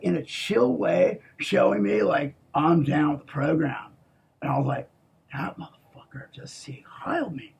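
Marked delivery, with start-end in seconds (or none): 5.3-7.5 hushed, unvoiced disbelief; stunned whisper. Recalling his own thought while hiding, a stunned whisper. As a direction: breathe it out in shock.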